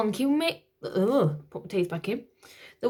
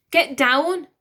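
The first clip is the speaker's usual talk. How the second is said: sharp command; 6 semitones higher, 7 dB louder than usual. Acted. Nanny shoves prince down.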